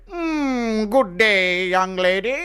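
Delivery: high, hammed-up gallant greeting; old-time gentleman suitor. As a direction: purr it like a mustache-twirling charmer. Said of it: "You go up to them and you say".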